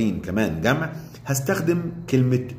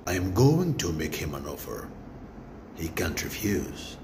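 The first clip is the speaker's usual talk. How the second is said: slow, low menace, dropping softer; menacing; 4 semitones lower, 7 dB quieter, much slower than usual. Shown as a demonstration. Teacher acting out an offer he can't refuse, quietly menacing. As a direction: deliver it slowly as a quiet, low threat. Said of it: "وتحمر له عينيك كده وأنت بتقولها".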